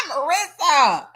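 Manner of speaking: high burst of laughter into a yell of Marissa; laughing shout. Real, not acted. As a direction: laugh it out and call the name.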